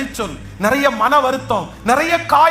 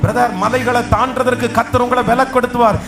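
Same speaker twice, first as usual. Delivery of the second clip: fiery preaching; 5 semitones lower than usual. Acted. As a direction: proclaim God's strength over them. Preaching at full force.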